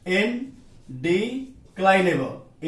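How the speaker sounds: very slow, split into separate parts; slow dictation. Shown as a demonstration. Teacher repeats the term for students writing along, slow dictation. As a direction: spell it out slowly, part by part.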